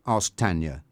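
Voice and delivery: ordinary delivery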